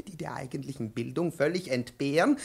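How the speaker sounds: ordinary talk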